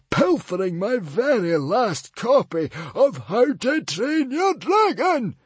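very high, loud, slow screech; the librarian screeching back at the mother. A narrator's reading. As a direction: screech it out in scorn. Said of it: "screeched the librarian".

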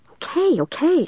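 high-pitched, exasperated agreement; impatient. A narrator's reading. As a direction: give in impatiently, pitch jumping up. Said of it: "I told them impatiently".

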